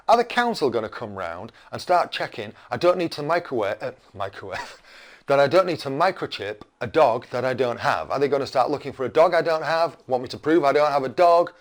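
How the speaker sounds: ordinary delivery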